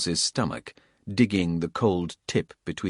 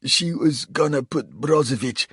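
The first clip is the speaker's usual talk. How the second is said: higher, strained voice of a bleeding traitor; wounded Coles; 7 semitones higher, 4 dB louder than usual. A narrator's reading. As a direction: spit it out pained and defiant. Narrator voices confessing Coles.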